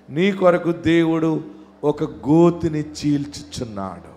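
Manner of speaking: usual speaking voice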